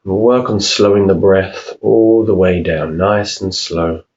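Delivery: unhurried meditation voice, long pauses between phrases; guided meditation. Real, not acted. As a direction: calmly guide them through each breath, leaving room to breathe. Leads a breathing meditation.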